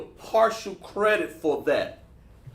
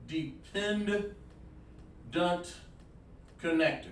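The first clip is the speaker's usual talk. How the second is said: drawn-out, softer repeat of the term; slow sounding out; 8 dB quieter, much slower than usual. Shown as a demonstration. Teacher repeating a term for the class, slowly and in pieces. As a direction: spell it out slowly, with a break between parts.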